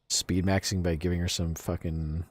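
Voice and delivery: normal talking voice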